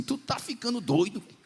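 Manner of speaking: high, incredulous friend's voice; friend's retort. Retold or imitated. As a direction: pitch way up, mocking disbelief. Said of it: "ele virou pra mim e falou".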